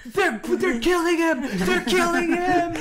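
high-pitched, drawn-out mock panic; hammed-up frantic wail down the phone; panicked caller. Imagined neighbor calling police, hammed-up high panic. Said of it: "The description must have been".

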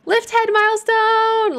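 high, loud, drawn-out cheer; excited squeal. Real, not acted. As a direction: squeal it out like a proud parent. Baby reaches milestone in game.

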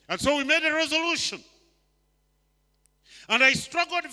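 loud, high, driving preacher's build; preaching at full force. Acted. Preacher rousing the church about failed resolutions, loud and fast.

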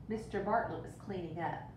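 plain reading voice

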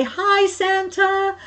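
high, loud, drawn-out call; long high greeting to get noticed; calling to Santa. Acted. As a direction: call out excitedly over a parade crowd. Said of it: "waved to him to say".

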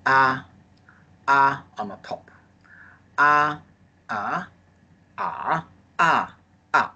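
first A held high on the top, then the other tones; four tones. Shown as a demonstration. Teacher drilling the class on the tones of a. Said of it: "first tone is high pitch".

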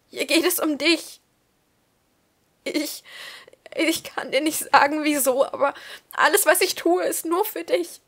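high, loud desperate pleading; tearful plea. Acted. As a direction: beg him to understand. Dado pleads with tearful Manu.

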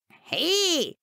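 sharp, high indignant "Hey!"; indignant cry. A narrator's reading. Billy protesting loudly at his father. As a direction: shoot it out high and loud in outrage. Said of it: "ruft Billy empört".

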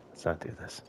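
whispered to himself; scared whisper near the beast. Real, not acted. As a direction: barely breathe it out, sneaking. Sneaking past a dinosaur.

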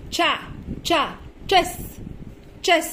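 short separate تشا, then the whole word تشيس; sounding out. Shown as a demonstration. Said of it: "السي والاتش فيها بنطقها بصوت".

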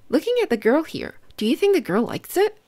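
usual speaking voice

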